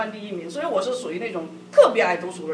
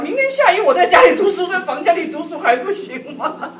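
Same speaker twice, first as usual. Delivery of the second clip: much higher, loud, laughing disbelief; exasperated retort; 7 semitones higher, 8 dB louder than usual. Retold or imitated. Wife silently answering husband's excuse, exasperated and laughing. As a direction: throw the question back with mock outrage.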